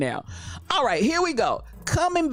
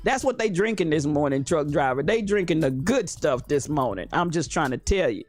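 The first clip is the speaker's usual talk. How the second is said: usual speaking voice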